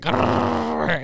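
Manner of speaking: imitated rolling growl; dog growl. Dog greeting the ex, imitated growl. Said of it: "she was like".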